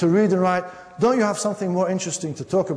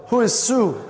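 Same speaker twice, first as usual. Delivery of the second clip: pointed, higher-pitched mock demand; suspicious fiancée; 5 semitones higher than usual. Fiancée challenging the machine about another woman, suspicious. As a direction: act the affronted fiancée.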